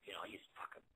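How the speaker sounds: fast whispered aside. Retold or imitated. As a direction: rush it under your breath.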